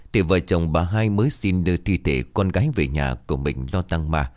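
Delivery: ordinary delivery, nothing marked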